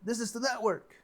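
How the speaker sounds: high, loud announcing call. Acted. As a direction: call it out like a greeting. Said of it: "It's like".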